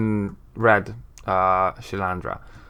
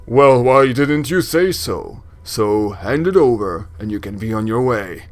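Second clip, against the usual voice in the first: booming voice; 3 dB louder than usual. Acted. Braskin agrees to take the delivery, booming. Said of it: "doing the booming voice".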